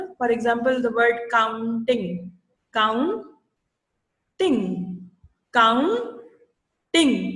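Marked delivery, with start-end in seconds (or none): 1.3-2.3 "counting" slowly broken as "coun-ting"; teacher's slow syllable-by-syllable "counting"; split into syllables. Shown as a demonstration. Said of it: "टुकड़ों में तोड़ के पढ़ाते हैं". 2.8-7.2 "counting" as "coun", long pause, "ting"; each syllable of "counting" stands alone; split into syllables. Shown as a demonstration. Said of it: "टुकड़ों में तोड़ के पढ़ाते हैं".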